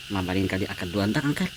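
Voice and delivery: laughing. Acted. Nephew asking uncle while laughing, soft and quick. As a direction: giggle it out shyly. Laughing through his request.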